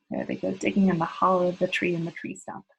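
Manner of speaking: ordinary delivery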